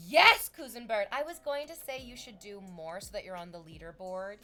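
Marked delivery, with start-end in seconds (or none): 0.1-1.1 loud, drawn-out yell of praise; cheer. Real, not acted. Streamer cheering a viewer's support, loud. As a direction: cheer for him.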